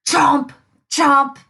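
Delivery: loud chewing noises; chomping. Acted. Wolf noisily finishing his lunch, loud chomps.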